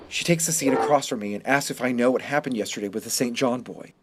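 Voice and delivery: ordinary delivery, nothing marked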